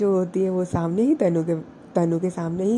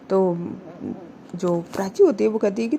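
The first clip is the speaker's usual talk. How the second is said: ordinary delivery, nothing marked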